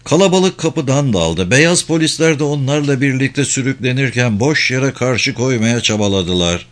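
usual speaking voice

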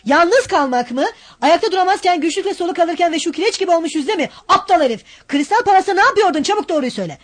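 high, loud exasperated rebuke; angry scolding. Acted. Razumihin berating pale Raskolnikov, angry high rebuke. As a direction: tear into him, exasperated.

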